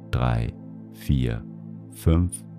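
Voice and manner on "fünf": ordinary delivery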